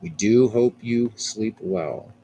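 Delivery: ordinary delivery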